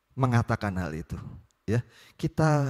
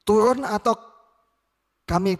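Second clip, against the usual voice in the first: much higher, louder menacing yell; threatening shout; 12 semitones higher, 8 dB louder than usual. Acted. Soldier threatening Elijah to come down, menacing shout. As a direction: threaten him, breaking off mid-threat. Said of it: "disertai dengan ancaman".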